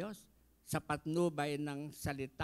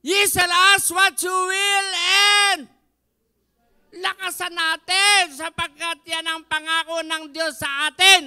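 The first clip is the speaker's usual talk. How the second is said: very loud, high shouting preacher; preaching at full force; 11 semitones higher, 16 dB louder than usual. Acted. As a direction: cry it out to the church. Preaching peak.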